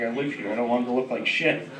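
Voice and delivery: low, soft, tentative explanation; meek and subdued; cautious approach. Retold or imitated. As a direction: plead your case quietly. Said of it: "I approached him fucking cautiously".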